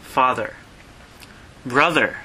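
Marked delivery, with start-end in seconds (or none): none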